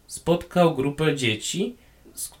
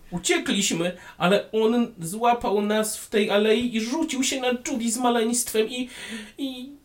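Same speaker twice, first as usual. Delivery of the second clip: thin voice; 8 semitones higher than usual. Acted. Gnome keeps telling, voice rising.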